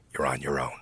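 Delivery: low, soft parting line; quiet finality. Retold or imitated. Comic points at the entire room before walking out, quiet finality. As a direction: drop right down and deliver it quietly.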